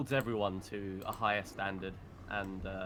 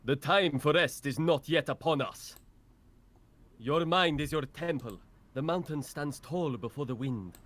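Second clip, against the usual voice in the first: louder and higher, an impassioned battle sermon; fierce warrior monk; 4 semitones higher, 3 dB louder than usual. Acted. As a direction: preach discipline like a stern martial master driving his pupil on. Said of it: "some out of context lines for him".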